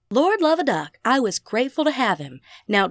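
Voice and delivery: ordinary delivery, nothing marked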